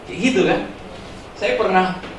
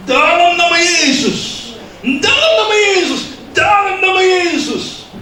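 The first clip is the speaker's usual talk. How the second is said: mock power-prayer shout, much higher and louder; shouted prayer formula; 14 semitones higher, 6 dB louder, slower than usual. Mocking a loud pray-er who thinks volume brings power, shouting. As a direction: imitate someone bellowing it like a mantra, high and drawn out. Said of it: "Semakin keras suaranya".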